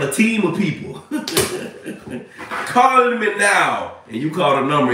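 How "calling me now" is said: higher, drawn-out psychic hotline catchphrase; Miss Cleo. Acted. Streamer mocking the hotline's famous ad, drawn-out psychic impression. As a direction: call it out like a TV psychic. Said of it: "when you call Miss Cleo".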